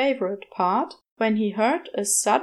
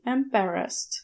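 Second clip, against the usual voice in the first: plain reading voice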